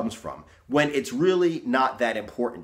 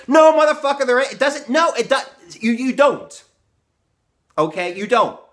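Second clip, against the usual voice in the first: much higher and louder, yelling in real anger; furious shout; 11 semitones higher, 8 dB louder than usual. Real, not acted. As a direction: blow up and shout him down. Ranting at excuse-makers.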